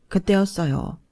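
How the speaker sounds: usual speaking voice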